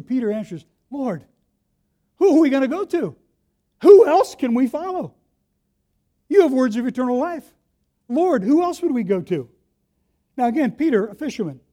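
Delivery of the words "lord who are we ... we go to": fervent reply. Acted. Peter answers Jesus fervently.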